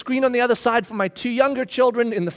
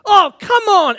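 exasperated; 8 semitones higher, 5 dB louder, slower than usual. Retold or imitated. Exasperated at the DVD player.

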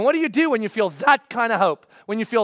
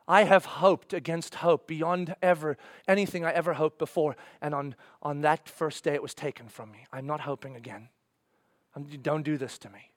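very low, much softer, broken and weary; hushed despair; 9 semitones lower, 9 dB quieter than usual. Pretend. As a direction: speak it like hope has been crushed out of you. Voicing despairing disciple.

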